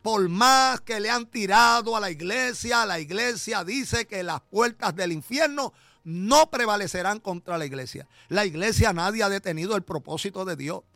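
loud, high, driving sermon climax; full-force preaching. Acted. Pastor exhorting the congregation, loud, high preaching. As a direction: preach it hard to the back pews.

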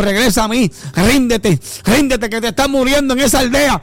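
rising, pleading preacher voice; preaching call. Acted. Preacher voicing God calling the lost son back, rising. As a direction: call them home with growing force.

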